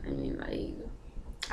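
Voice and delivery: breathy half-whispered aside; soft whispered hesitation. Real, not acted. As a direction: drop to a whisper as if admitting something. Drops to a whisper mid-thought.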